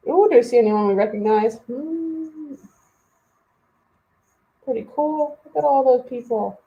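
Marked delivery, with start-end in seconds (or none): none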